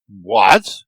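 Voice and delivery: sharp high shocked cry; the devil yelps in disbelief; cried out. A narrator's reading. Devil crying out at Erky's news, shocked high yelp. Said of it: "the devil cried".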